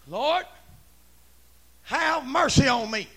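full-voiced, drawn-out plea; bold holler. Leper calling to Jesus for mercy, bold holler. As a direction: holler it out slowly and clearly. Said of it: "I'm saying".

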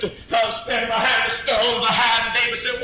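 normal talking voice

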